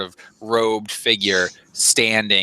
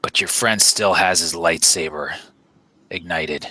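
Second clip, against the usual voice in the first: deep voice; 5 semitones lower than usual. Acted. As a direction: pronounce it deep and deliberate, pausing heavily. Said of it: "in this deep voice".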